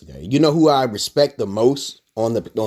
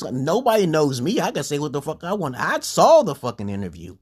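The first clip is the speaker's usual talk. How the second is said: ordinary talk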